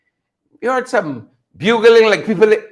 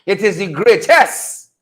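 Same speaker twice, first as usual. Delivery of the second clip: usual speaking voice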